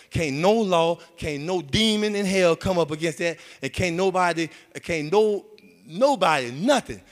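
loud, rising preacher cadence; preaching at full force. Acted. Preacher raising his voice to the church, loud. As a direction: hammer it home to the congregation.

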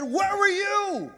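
slow, drawn-out, high mock-stern question; stern dad. The dad throwing the question back at his son, slow and pointed.

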